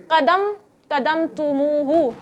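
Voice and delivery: قدم، قدمتموہ drawn out syllable by syllable; slow demo. Shown as a demonstration. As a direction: model قدمتموہ slowly for students. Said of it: "teacher models the word slowly". (قدمتموہ آہستہ کھینچ کر ادا)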